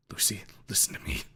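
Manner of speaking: whispered, pleading with Lucy; hushed plea. A narrator's reading. Ripley whispering to Lucy to calm her. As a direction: breathe it to her gently. Calming Lucy before raising voice.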